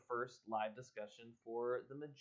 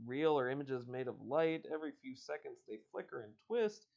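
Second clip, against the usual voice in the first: ordinary talk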